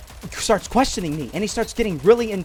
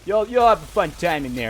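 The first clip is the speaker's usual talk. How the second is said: lightly voiced frat bro; a hint of laid-back frat boy. American traveler leaving the search, lightly imitated frat boy. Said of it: "biggest frat boy in the entire world".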